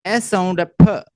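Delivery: ordinary talk, nothing marked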